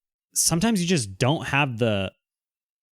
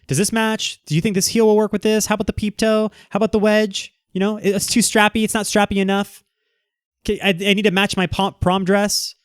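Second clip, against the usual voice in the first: much higher mock customer voice rattling off questions; picky customer; 7 semitones higher than usual. Shoe customers quizzing the salesman, mock high voice. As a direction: play the fussy shopper, pitched up and eager.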